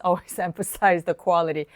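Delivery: normal talking voice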